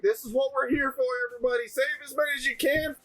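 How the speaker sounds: high, ringing announcement over a loudspeaker; rally everyone through a megaphone. Acted. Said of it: "pop out a loudspeaker and be like".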